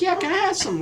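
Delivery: put-on very high little-kid voice; imitating young self. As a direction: squeak out an order like a kid. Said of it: "I was a kid".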